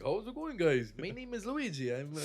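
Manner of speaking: high-pitched put-on disguise voice; Luigi. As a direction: pretend to be a stranger greeting the group. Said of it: "in my disguise".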